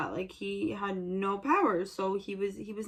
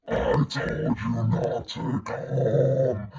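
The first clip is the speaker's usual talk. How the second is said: very low, slow monster voice; Freddy Krueger; 9 semitones lower, 4 dB louder, much slower than usual. Retold or imitated. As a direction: drag it out as a deep menacing threat. Voices Freddy as a menacing killer.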